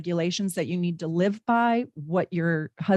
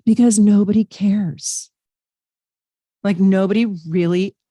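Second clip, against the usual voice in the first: emphatic; 7 dB louder than usual. Real, not acted. Driving home the message.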